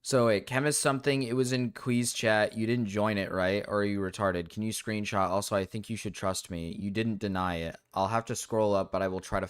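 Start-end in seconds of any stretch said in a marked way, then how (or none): none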